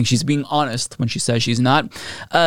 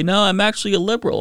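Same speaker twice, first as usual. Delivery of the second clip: mocking quote; 7 semitones higher, faster than usual. Mocking the right-wing grifters.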